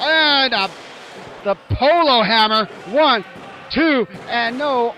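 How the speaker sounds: loud ringside count, near-fall. Real, not acted. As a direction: belt out the pin count.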